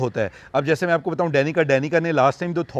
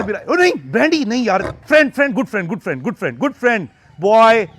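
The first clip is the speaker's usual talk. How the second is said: suddenly raised voice, coaxing the cat; calling to cat; 5 semitones higher, 6 dB louder than usual. Real, not acted. Owner suddenly turns from viewers to scold Brandy, loud coaxing. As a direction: break off and call the cat firmly.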